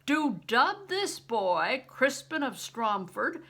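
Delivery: slow, loud ceremonial decree; booming joyful declaration; roar. A narrator's reading. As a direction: proclaim it to the world. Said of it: "he roared loud enough for all the world to hear".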